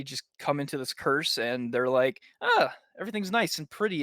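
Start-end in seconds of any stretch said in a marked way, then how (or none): 2.4-4.0 high, quick airy-headed imitation; lightly hammed-up contentment; mock blissful. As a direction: play someone lulled into bliss, pitched up. Acting out characters lulled by curse.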